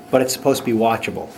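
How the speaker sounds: ordinary talk, nothing marked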